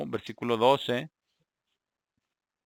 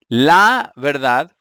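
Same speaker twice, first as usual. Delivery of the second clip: LA drawn out long and loud; emphatic, slow "la" before "verdad"; stress on LA; 10 dB louder, much slower than usual. Shown as a demonstration. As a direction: lean hard on "la", stretching it slowly. Teacher highlights the feminine article.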